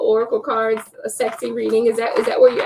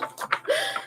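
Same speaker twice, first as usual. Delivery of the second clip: breathy laugh; 10 dB quieter than usual. Real, not acted. Laughing at self-love joke.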